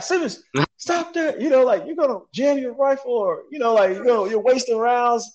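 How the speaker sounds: high, loud mock shout of his buddy; retold buddy yelling at him to stop. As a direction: bark it at him over gunfire. Buddy yelling at him mid-firefight.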